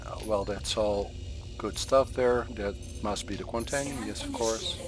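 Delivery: ordinary talk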